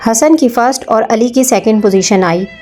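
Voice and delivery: usual speaking voice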